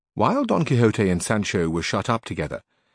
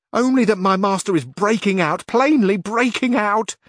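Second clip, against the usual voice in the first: put-on much higher, louder worried old woman's voice; fretful housekeeper; 12 semitones higher, 5 dB louder than usual. A narrator's reading. Housekeeper tells Carrasco her master is breaking out, high fretful voice. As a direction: wail it like an anxious housekeeper, slowing at the end.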